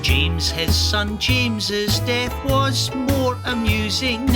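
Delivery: usual speaking voice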